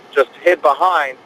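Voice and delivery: usual speaking voice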